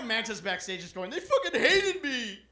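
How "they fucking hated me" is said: high, loud, hammed-up wail; mock crying. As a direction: wail it like a hurt comic begging for reassurance. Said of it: "I made Manson cry".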